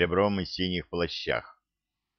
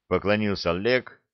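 ordinary delivery

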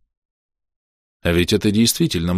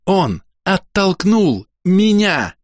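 high, slow, word-by-word outrage; seething, high-pitched accusation; furious growl; 12 semitones higher, 3 dB louder, much slower than usual. A narrator's reading. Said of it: "прорычала Хоули".

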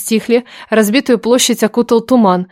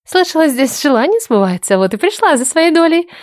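sly woman; 6 semitones higher than usual. Acted. Ulyana, higher female voice.

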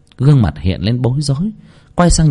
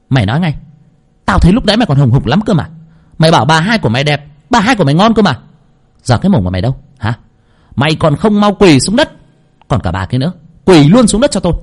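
fast, higher angry scolding; furious interrogation; 6 semitones higher, faster than usual. Acted. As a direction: tear into the servant boy. Furious girl interrogating Nam. (giọng quát tháo giận dữ)

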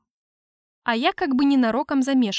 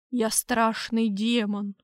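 hoarse; much slower than usual. Acted. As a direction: rasp it like a menacing demon. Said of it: "прохрипел я, наконец доживав".

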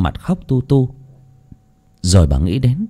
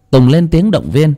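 ordinary delivery

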